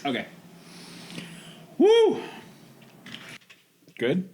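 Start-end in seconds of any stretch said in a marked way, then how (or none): 1.8-2.2 high, loud whoop; excited whoop. Real, not acted. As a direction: cheer it out.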